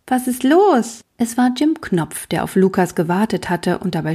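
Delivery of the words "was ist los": put-on high, light boy's voice; sudden high-pitched question; bright voice. A narrator's reading. Little Jim asks Lukas what's wrong, in a bright voice. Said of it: "plötzlich eine helle Stimme fragte".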